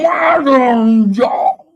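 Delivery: loud, high, drawn-out holler; mock modern y'all. Debater showing how opponents supposedly sound, loud mocking holler. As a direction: stretch it out in a hammed-up mocking yell. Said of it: "I don't sound like".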